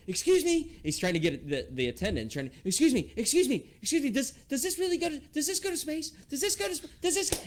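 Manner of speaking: put-on very high, loud pleading child voice; desperately flag down the attendant like a panicked kid; frantic little boy. Terrified son begging the ride attendant for answers, very high and loud. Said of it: "he's going".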